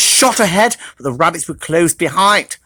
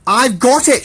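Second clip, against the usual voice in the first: shouted; 6 semitones higher than usual. Acted. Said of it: "shouted Hedgehog".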